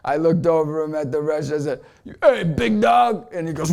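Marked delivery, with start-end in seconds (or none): 2.2-3.3 loud, raised, drawn-out hail; retold calling out. Speaker greeting a guy in a restaurant, calling out. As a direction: hail a stranger across the room. Calls across to a stranger.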